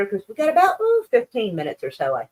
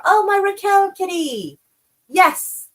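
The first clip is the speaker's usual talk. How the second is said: cutesy pet voice; 12 semitones higher, slower than usual. Acted. Greeting a friend in chat.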